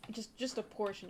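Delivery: usual speaking voice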